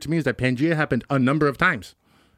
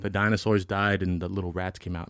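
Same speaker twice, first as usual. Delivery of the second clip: usual speaking voice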